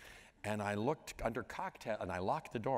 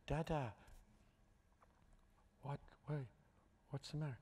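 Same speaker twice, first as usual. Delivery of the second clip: put-on small, high, soft child voice; three-year-old son; 5 semitones higher, 8 dB quieter than usual. Toddler son to crying dad, imitated small soft voice. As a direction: imitate a worried little boy, high and hushed. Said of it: "he's three, a little over three".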